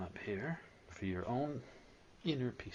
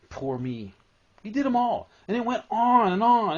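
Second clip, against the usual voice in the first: high, loud mocking of a tantrum; loud mock whining, higher and louder; mock whine; 10 semitones higher, 14 dB louder than usual. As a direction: ham up the child's poor-me tantrum.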